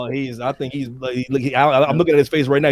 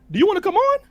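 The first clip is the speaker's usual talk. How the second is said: excited call; 10 semitones higher, faster than usual. Real, not acted. Host calling out to a guest.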